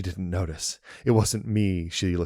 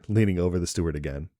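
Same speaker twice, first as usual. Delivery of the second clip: ordinary delivery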